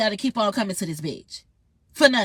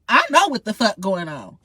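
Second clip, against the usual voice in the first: usual speaking voice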